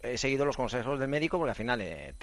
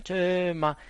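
mock crowd chant, higher and louder; grito del público imitado; 6 semitones higher, 5 dB louder than usual. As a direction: call the name out like a cheering stadium. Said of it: "gritaban mi nombre". (imitando el grito del público)

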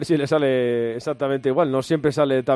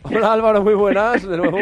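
laughing; 3 semitones higher, 4 dB louder than usual. Real, not acted. Laughing greeting on reconnection.

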